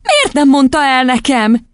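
put-on high, drawn-out little-girl question; high-pitched childish reproach; reproachful child. A narrator's reading. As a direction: ask as a hurt child.

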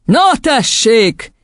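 high, drawn-out gloating shout; triumphant cry. A narrator's reading. Headmistress catching the class out, triumphant. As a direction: crow it slowly. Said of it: "kiáltott diadalittasan Doron kisasszony".

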